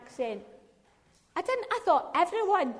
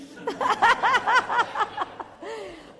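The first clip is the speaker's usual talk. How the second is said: high staccato laugh; real high, rattling laugh; 9 semitones higher, 5 dB louder than usual. Real, not acted. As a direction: burst into high, rapid-fire laughter. Laughs at her anecdote.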